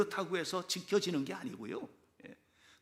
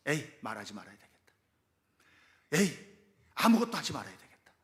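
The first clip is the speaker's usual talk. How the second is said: fed-up resolve; 4 semitones lower, 5 dB louder, faster than usual. Retold or imitated. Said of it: "이렇게 결심을 한 겁니다".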